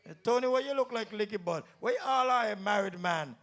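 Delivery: ordinary talk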